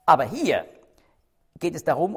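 ordinary talk

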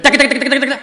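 rapid-fire "taka-taka" gibberish on one high note; mock speaking in tongues, loud high monotone rattle; machine-like string of meaningless syllables; imitated tongues babble. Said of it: "Und das Sprachenreden, dieses, dieses Lallen".

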